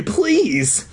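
high, drawn-out meek plea; a small, meek afterthought of begging. A narrator's reading. Trapped kid adding a plea to the scowling man, meek. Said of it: "I added meekly".

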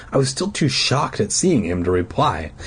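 ordinary delivery, nothing marked

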